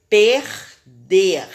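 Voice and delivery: PERDER in two loud syllables, both Rs strong. Shown as a demonstration. Teacher showing R mid-word and final, strong R in "Perder". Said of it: "o som também saiu forte".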